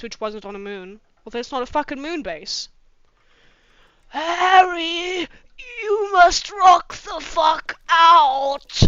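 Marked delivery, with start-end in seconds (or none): 4.1-8.8 put-on slow, drawn-out old man; creaky old wizard calling out. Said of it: "I'm doing old man voice".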